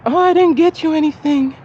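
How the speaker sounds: lightly mimicking her sorry; apologetic woman. Voicing her guilty reply.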